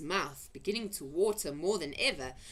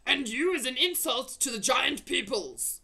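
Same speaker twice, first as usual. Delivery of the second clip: loud, higher giant insult; angry shout; 6 semitones higher, 6 dB louder than usual. A narrator's reading. Blood bottler insulting the BFG, shouting. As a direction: shout it back at him. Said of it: "shouted the blood bottler".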